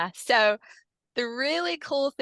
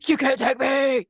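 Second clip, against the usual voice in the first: menacing entity voice, raised and louder; entity speaking through the client; 5 semitones higher, 4 dB louder than usual. Retold or imitated. Hypnotist recalling the possessed client's threat, menacing entity voice. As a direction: voice the thing that took over her, defiant. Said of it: "and she goes".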